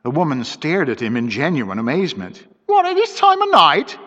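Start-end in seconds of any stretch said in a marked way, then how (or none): 2.7-4.0 high, loud astonished exclamation; housekeeper, amazed at a night errand; exclaiming. Acted. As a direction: shoot the voice up in disbelief. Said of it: "she exclaimed".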